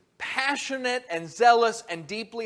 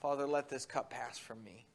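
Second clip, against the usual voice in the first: hushed prayer; 8 semitones lower, 14 dB quieter than usual. Acted. Jesus praying in Gethsemane.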